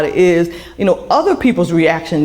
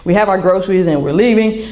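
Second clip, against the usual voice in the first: ordinary talk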